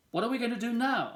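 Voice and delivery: put-on high, fast, pushy boy voice; bored demanding kid. Wendell pestering Sophie for something to do, high and fast. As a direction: needle her, quick and high-pitched.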